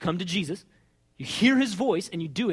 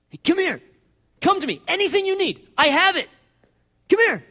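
high, loud, insistent calling; urgent, high-pitched invitation; eager beckoning; 11 semitones higher, 6 dB louder than usual. Acted. Voices Jesus calling people over.

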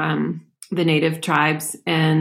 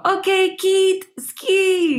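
put-on much higher, Argentine-accented English call; Damien's Argentine accent; 13 semitones higher, much slower than usual. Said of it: "with his awesome accent".

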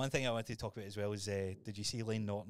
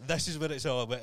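hyped-up quote; 4 semitones higher, 8 dB louder than usual. Retold or imitated. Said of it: "He goes".

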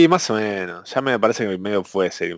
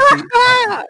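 a real, sudden high burst of laughter; loud, high-pitched shriek of laughter; 4 dB louder than usual. Real, not acted. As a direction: crack up at the joke.

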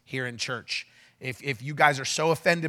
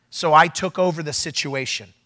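ordinary talk, nothing marked

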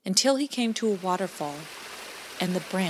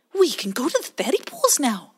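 high, eager little otter voice; ready to go; 7 semitones higher, 4 dB louder than usual. Acted. Bowie urges Pie Jam to the boat, eager.

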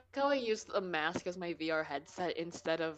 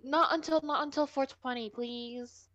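much higher, begging whine; high pleading; 9 semitones higher, 3 dB louder than usual. Real, not acted. Girl begs the others not to end the podcast.

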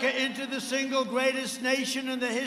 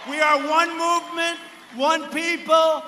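rally shout; 4 semitones higher, 5 dB louder than usual. Real, not acted. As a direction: build to a roar. Rally chant climax.

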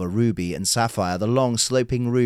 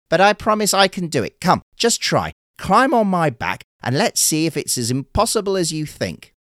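higher, louder coaxing turtle; 8 semitones higher, 4 dB louder than usual. Acted. Turtle urges the fisherman to climb on, higher and coaxing. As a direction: coax him on, eager.

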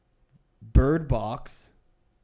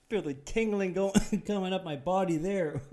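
laughing; 6 semitones higher, 3 dB quieter than usual. Real, not acted. Laughing while feeling effects.

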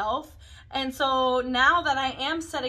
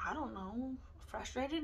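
mumbled; 11 dB quieter than usual. Retold or imitated. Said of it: "And I'll be like".

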